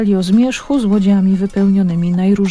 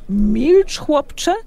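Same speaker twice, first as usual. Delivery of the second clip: angry; 7 semitones higher than usual. A narrator's reading. Eryk rounds on the young fisherman, angry. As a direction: snap at the boy in anger. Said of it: "uniósł się Eryk".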